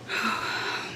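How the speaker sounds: a soft sigh of being overcome; emotional sigh. Real, not acted. Speaker at graduation, overcome mid-speech, sighs softly. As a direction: let out a breath to gather yourself. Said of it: "I'm emotional".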